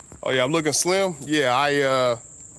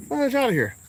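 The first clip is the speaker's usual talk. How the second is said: much higher, shooing the bug off; 12 semitones higher than usual. Real, not acted. Driver shoos the bug off his car, high. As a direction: shoo it away, high.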